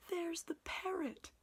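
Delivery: very high, delighted squeal; excited cry. A narrator's reading. Scrooge seeing the parrot, crying out in delight. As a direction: squeal at the sight of it. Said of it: "cried Scrooge".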